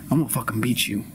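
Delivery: ordinary talk, nothing marked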